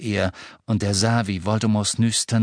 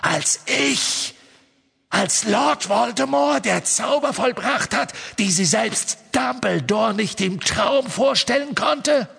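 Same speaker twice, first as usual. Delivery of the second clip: Voldemort; 8 semitones higher than usual. Acted. Voldemort scoffs at Harry.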